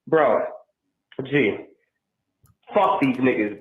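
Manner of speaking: ordinary delivery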